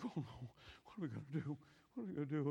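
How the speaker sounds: low, hushed, helpless murmur; mock dismay. Soldiers facing the giant, hushed mock dismay. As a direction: imitate cowed soldiers very quietly.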